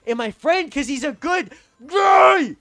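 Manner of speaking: loud, high wail on GUY; slow, high outburst of frustration; exasperated shout. Real, not acted. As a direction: yell it, dragging out "guy". Exasperated outburst defending his friend.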